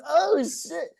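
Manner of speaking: high squeaky oh shit; shocked oh shit. Retold or imitated. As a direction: jump up in pitch as if caught off guard. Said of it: "And I was like".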